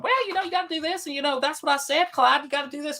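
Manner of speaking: very high-pitched, nasal Tennessee twang; Tennessee accent. Retold or imitated. Imitating Tennessee women telling Clyde what to do, high nasal accent. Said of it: "the Tennessee accent is very up high".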